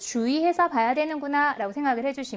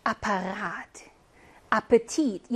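Apparat, Appetit, each with its last syllable stressed and drawn out; third-syllable stress; 4 semitones lower, much slower than usual. Shown as a demonstration. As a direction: model Apparat, Appetit, landing on RAT and TIT. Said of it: "얘네가 다 삼 음절의 강세".

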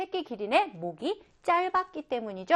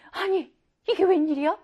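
acted astonishment, pitched up; higher-voiced, taken-aback question; startled surprise; 6 semitones higher than usual. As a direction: react to a shocking turn. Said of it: "깜짝 놀란 일이 생겼어요".

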